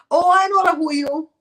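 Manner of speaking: usual speaking voice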